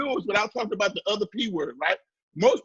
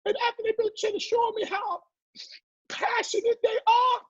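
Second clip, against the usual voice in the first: mock teary high voice; 13 semitones higher than usual. Still mocking the teary entrepreneurs.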